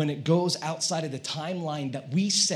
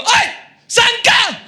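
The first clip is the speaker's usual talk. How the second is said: high, loud, drawn-out shout; hammed-up phone yell; angry calling out; 11 dB louder, slower than usual. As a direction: holler at the late driver. Angry call to late delivery driver.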